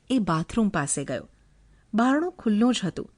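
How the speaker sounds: ordinary delivery, nothing marked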